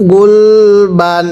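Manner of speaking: গুলবান stretched very slowly, ending on a dental n; teacher modelling গুলবান slowly for students; slow drawn-out reading. Shown as a demonstration. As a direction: read গুলবান syllable by syllable, slowly, with a clear final n. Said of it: "আবারও পড়বেন".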